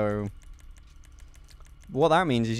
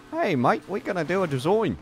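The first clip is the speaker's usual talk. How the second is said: higher, wobbly mock-Australian accent; 7 semitones higher, 3 dB louder than usual. Gamer playing with the word design, wobbly Australian accent. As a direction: try on a jokey, unsteady Australian accent. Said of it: "It was kind of Australian for a moment".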